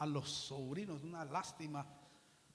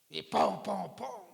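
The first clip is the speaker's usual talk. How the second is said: mock gunshots; 7 dB louder, slower than usual. Imitating gunshots in story.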